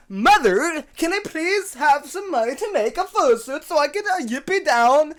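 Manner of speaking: high-pitched, loud mock begging; mock pleading child. Host mimicking daughter asking mother for fursuit money, mock high pleading.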